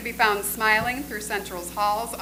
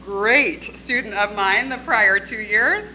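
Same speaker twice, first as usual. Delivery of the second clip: "great student of mine the prior two years" slow and louder, each word leaned on; all-caps emphasis; 5 dB louder, slower than usual. Shown as a demonstration. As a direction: read it as if the letters were all capitals. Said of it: "all capitals".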